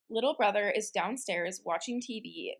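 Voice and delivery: ordinary talk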